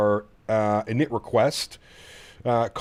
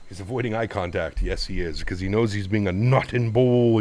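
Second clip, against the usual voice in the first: ordinary talk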